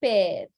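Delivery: père with an open "eh" vowel; French grave accent. Shown as a demonstration. Teacher demonstrating the grave accent, open French vowel in père. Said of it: "So you see the pronunciation".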